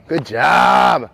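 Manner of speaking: loud, drawn-out cheer; shouted praise. Real, not acted. Coach to player after the last pick, loud cheering praise. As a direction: call out praise across the field.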